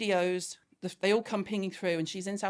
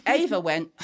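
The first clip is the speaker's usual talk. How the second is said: ordinary talk, nothing marked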